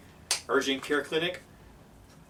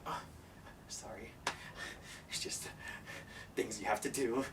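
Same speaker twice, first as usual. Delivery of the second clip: very soft, whispery clerk; hushed apology; 9 dB quieter than usual. Retold or imitated. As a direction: murmur an apology, almost whispering. Clerk apologizing over broken computer.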